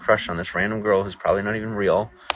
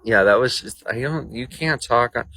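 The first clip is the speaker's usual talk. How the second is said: normal talking voice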